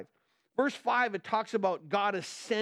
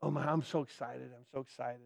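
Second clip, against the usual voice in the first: mock excited, much softer and lower; flat mock excitement; 7 semitones lower, 7 dB quieter, faster than usual. Pastor mocks quiet churchgoers with flat, hushed excitement. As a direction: say you're thrilled with no energy at all. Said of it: "excitement in the church does not come with a decibel increase".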